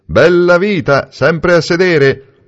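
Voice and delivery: high, slow, teasing drawl; teasing gentleman. A narrator's reading. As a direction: needle him about his idleness. Valentini teases Niccolò.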